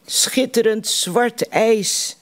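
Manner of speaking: called out. A narrator's reading. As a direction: call it out slowly, marvelling at the ice. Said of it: "riep ik".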